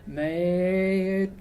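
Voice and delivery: slow, drawn-out creepy man's voice. A narrator's reading. Vanessa's call comes out like a creepy slow-talking man. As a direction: let it come out wrong, like a creepy man. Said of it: "some creepy, slow-talking, joking man's".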